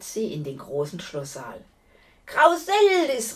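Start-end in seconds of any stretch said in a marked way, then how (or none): 2.3-3.4 loud, drawn-out challenge; calling out. A narrator's reading. Knight summoning the giantess, loud high call. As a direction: call out high and slow across a great hall. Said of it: "rief sie".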